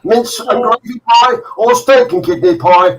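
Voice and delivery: put-on loud, high Birmingham accent; thick Brummie accent. Man offering the cook a list of pies, loud thick Brummie accent. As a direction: reel it off in a broad, comic Brummie. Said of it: "brummy accent".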